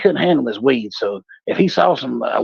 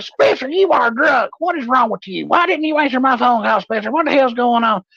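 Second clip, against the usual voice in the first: put-on much higher, scolding girlfriend voice; 12 semitones higher than usual. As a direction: tell him off in a high voice. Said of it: "She's like".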